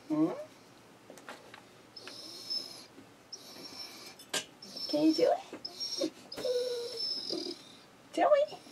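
high, coaxing sing-song pet voice; pet talk. Real, not acted. Owner coaxing her pet bird to say I love you, high coaxing voice. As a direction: sweetly coax the bird to speak.

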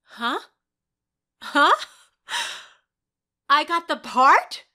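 high loud disbelief; can't believe it; astonished. Real, not acted. Actress learning she got the part, high loud disbelief.

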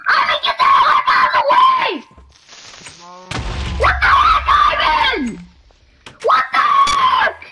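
real screaming, very high and loud; angry shout. Real, not acted. Player yelling at game partner to move, angry shout. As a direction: scream it at full force.

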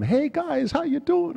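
high, loud hammed-up hollering; boisterous over-the-top shout across the set; mock yelling greeting. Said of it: "I'm yelling and screaming".

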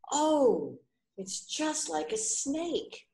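high, slow amazed voice for the snake guess; surprised blind man. Acted. Second blind man's surprised guess.

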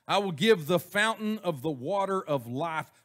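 booming scripture read. Acted. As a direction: proclaim it like the voice of God. Reading God's words from Revelation.

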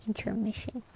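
"intermission" with the T sounded, said softly; T pronounced. Shown as a demonstration. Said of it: "you actually pronounce the T".

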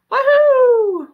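high, loud victory whoop. Acted. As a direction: cheer the winning goal. Paul cheers his goal.

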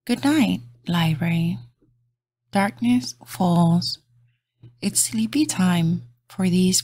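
gentle bedtime storybook read; reading to children. Acted. Reading a bedtime picture book aloud to kids, soft and gentle. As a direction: lull little listeners toward sleep.

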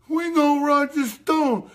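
high, slow parody voice; mocking the critics. Host imitating angry critics of Roger Stone, high and slow. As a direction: say it like a smug accuser. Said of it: "they're pissed at him, like".

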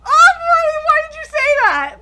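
high, loud laughing whine; laughing dismay. Real, not acted. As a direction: laugh in despair.

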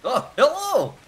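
squeaky high hello; high silly greeting. Acted. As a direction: echo it up high.

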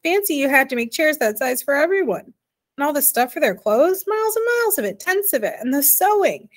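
high, animated disbelief; incredulous amusement. A narrator's reading. Arrietty ridiculing a world of giants, amused and incredulous. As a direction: marvel at the absurdity, pitch leaping up. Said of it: "helplessly laughing again".

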